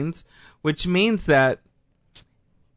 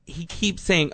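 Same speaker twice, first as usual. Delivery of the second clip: normal talking voice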